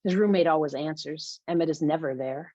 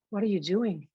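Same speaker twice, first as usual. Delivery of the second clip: plain reading voice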